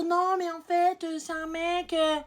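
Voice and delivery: mock hesitant excuse. Girl defending her man to a friend, mock hesitant. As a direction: imitate someone feebly stretching out an excuse.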